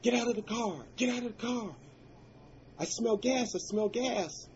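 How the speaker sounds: high, loud panicked shouting; retold scream. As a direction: yell the warning in panic, pitched high. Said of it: "I heard screaming coming from the car".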